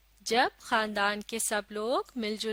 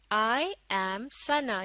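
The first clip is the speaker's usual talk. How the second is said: very slow, word by word; slow model reading; much slower than usual. Shown as a demonstration. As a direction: read it out slowly for learners to follow. Second model reading for learners. (धीरे-धीरे, एक-एक शब्द पढ़कर)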